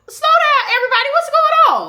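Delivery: very high, loud falsetto to settle things; calming everyone down. Retold or imitated. Sister coming out of class to her crying brother, shrieking high. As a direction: squeal it out, calming the crowd. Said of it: "so I'm like".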